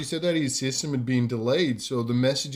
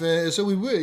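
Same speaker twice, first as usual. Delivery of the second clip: normal talking voice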